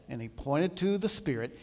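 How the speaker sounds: ordinary talk, nothing marked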